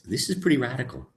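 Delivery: ordinary talk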